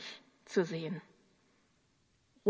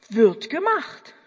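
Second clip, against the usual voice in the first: louder, slow, snappy Wird gemacht; eager boy's reply; 4 semitones higher, 7 dB louder, slower than usual. Retold or imitated. Boy answering God's command, crisp and eager. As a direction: say it like a keen young worker. Boy repeats motto to God.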